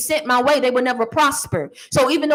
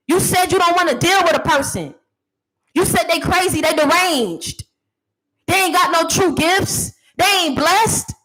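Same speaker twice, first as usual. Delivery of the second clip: mock-scolding rant; 5 semitones higher than usual. Real, not acted. Ranting at a critic.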